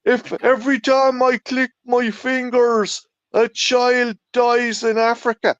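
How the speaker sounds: high, mock-earnest preachy voice; a sneering send-up of a pious rock star.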